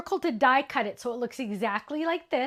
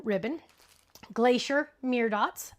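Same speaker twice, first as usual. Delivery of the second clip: usual speaking voice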